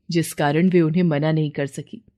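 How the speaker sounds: usual speaking voice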